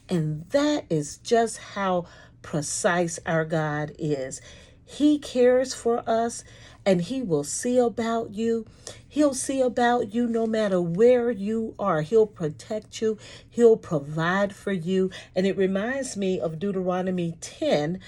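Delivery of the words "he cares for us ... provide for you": preaching. Acted. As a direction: lift the congregation, testify. Preaching about God's care.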